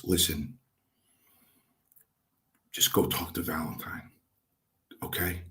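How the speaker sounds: retold confidential coaxing, voice dropped; quiet aside. Trainer walks rookie away with arm round him, quiet aside.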